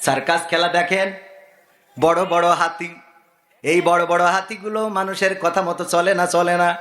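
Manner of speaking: chanting sermon with sudden high-pitched surges; singsong preaching. Acted. Preacher to congregation on animals obeying man, singsong exhortation. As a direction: preach in sustained tones, stretching the phrase endings.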